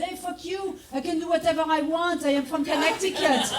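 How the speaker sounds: high, snotty entitled voice; rude tourist. Retold or imitated. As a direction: sneer like an entitled brat.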